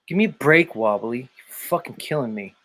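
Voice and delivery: usual speaking voice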